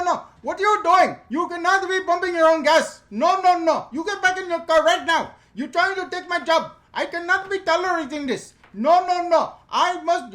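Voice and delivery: high, loud put-on Indian accent. Gas attendant in Jersey scolding him, Indian accent. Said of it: "so I'm gonna stop with the accent".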